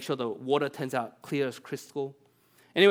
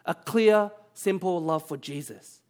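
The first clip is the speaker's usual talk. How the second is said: raised, louder preaching voice; fervent sermon build, higher and louder; 6 semitones higher, 6 dB louder than usual. Acted. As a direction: lift it like a pulpit climax. Preacher drives home the point.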